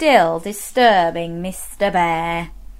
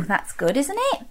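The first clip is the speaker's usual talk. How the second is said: ordinary delivery, nothing marked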